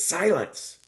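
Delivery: high, loud, sharp rebuke; the master shouts his pupil down to silence; scolding shout. Acted. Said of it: "scolds him and says".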